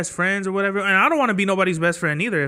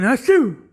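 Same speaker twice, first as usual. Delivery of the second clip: high, loud mock "achoo"; a cartoonish sneeze, higher and louder; imitated sneeze; 7 semitones higher, 5 dB louder than usual. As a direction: sneeze it out for the story. Imitating the man sneezing again.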